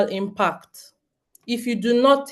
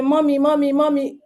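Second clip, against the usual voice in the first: put-on higher, nagging little-kid call; child calling mommy; 6 semitones higher than usual. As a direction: imitate a child tugging for attention. Kids calling mom for attention.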